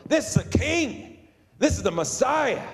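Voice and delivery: high-pitched, jubilant acclaim imitating the crowd; exuberant praise. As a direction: cry it out unashamed, pitch leaping up. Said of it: "They had that exuberant, unashamed praise".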